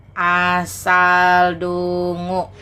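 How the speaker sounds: slow mocking drawl. Mocking pun on asadu.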